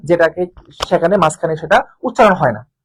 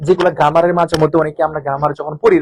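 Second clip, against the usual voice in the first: ordinary delivery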